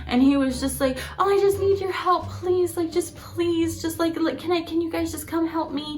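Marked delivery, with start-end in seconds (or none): 1.2-6.0 high-pitched retold pleading; mock-desperate, pitched up and insistent; freaking out. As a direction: imitate a neighbor begging for help. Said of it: "just freaking out and just like".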